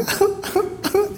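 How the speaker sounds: high, gasping fake sobs; mock sobbing. Father imitating teenage daughter crying in the kitchen, mock sobbing. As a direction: imitate a girl's hitching sobs. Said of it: "she's crying".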